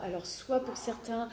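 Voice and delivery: ordinary talk, nothing marked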